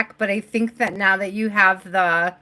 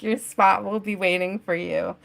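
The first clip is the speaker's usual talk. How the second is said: usual speaking voice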